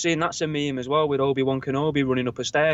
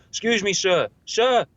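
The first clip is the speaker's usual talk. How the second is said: louder, higher insistent sir; louder polite call; 6 semitones higher, 3 dB louder, slower than usual. Retold or imitated. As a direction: call him again, pushing harder. Calling louder, not heard.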